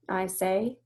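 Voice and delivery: usual speaking voice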